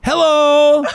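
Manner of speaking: put-on very high, loud child's hello, stretched out; kid's loudest hello. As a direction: call hello up high like a five-year-old who isn't answered. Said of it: "and then he goes".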